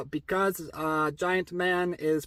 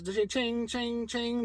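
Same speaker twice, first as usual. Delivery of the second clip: high-pitched sword clinks; sing-song mock clash of swords; sword clashing noises; 6 semitones higher, 4 dB quieter than usual. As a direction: imitate blades ringing, high and light.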